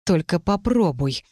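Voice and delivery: restrained, menacing warning; hissed threat. A narrator's reading. Mage warns rival off his bride, hissing. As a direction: hiss it as a warning. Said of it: "прошипел Каэль".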